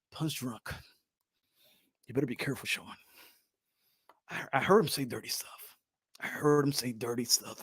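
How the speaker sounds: low, soft, whispered warning; hushed mock pastor; pastor's hush. Voicing the disapproving pastor.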